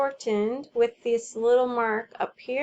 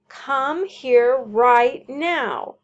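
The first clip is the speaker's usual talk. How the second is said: louder, slow, forceful order; exclamation; 7 dB louder, much slower than usual. Shown as a demonstration. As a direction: demand it firmly. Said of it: "This is an exclamation".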